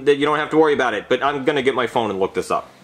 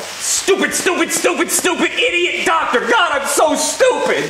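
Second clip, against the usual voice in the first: very high far shout at yourself; shrieking self-hate; slower than usual. Acted. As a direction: scream at your own stupidity. Doctor berates himself, boiling water.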